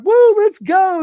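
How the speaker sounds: very high, loud, slow stretched whoop; hype shout. Retold or imitated. Hyping himself, energy called crazy.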